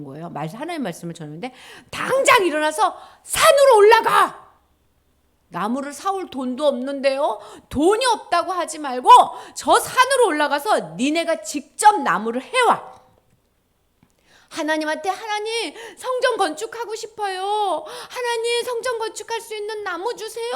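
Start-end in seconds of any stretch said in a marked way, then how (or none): 1.9-13.0 loud, high booming command; commanding shout. Acted. God ordering the people up the mountain, shouting. 15.1-20.5 pleading cry. Acted. People pleading for wood.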